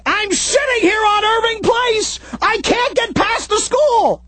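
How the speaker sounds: high, slow shouted complaint; urgent shout on phone. Retold or imitated. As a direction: yell it down the line, stuck in traffic. Frantic call to Gary stuck.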